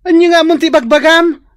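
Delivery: loud, high indignant demand; outraged. Acted. Friend scolding the heartbroken woman, loud and outraged. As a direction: throw the question back, appalled.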